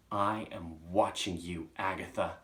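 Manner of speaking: slow, weighty, deliberate; ominous warning. Acted. Chalk signing off to Agatha, slow and ominous. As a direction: let each word loom.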